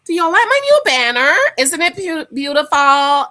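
loud, drawn-out sing-song delight; excited showing off. Real, not acted. Proudly showing viewers her new banner, loud and gushing. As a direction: gush over it, stretching every word.

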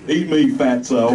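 loud, slow jeer; insult yelled. Acted. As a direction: sneer it out. Soldiers yelling at Limbaugh.

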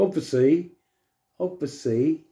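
"obviously" squashed to "obvsy", rising; clipped footballer "obviously", said twice; footballer's obviously. Shown as a demonstration. Repeating the footballer's clipped "obviously". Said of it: "They say".

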